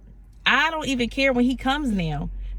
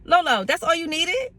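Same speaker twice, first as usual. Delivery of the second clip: higher, incredulous what?; stunned disbelief; 6 semitones higher than usual. Retold or imitated. Talking to her car, high-pitched disbelief.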